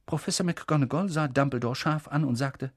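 usual speaking voice

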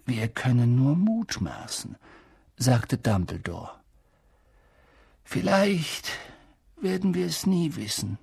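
put-on calm, thoughtful old headmaster; hint of a wise old man musing; lightly voiced old wizard. A narrator's reading. Old headmaster answering the professor calmly.

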